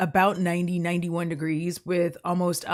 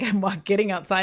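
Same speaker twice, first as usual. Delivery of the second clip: normal talking voice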